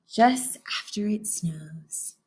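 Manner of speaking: slow airy whisper. Acted. Moose savoring the crisp air, whispered. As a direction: hush it dreamily. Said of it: "sniffed Moose".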